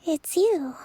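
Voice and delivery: ordinary talk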